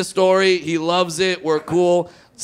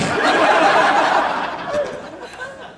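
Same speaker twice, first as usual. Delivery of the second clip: laughs high and airy at his own setup; cracking up at the absurd plan; high breathy laugh. Real, not acted. Comedian laughing at himself mid-story.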